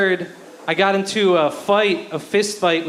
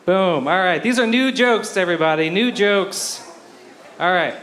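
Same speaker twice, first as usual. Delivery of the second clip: ordinary talk, nothing marked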